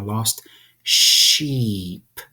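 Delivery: "sheep" drawn out and falling; slow demo. Shown as a demonstration. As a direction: stretch "sheep" for the class. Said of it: "Say it slowly".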